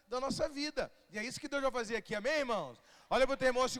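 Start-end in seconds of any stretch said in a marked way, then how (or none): none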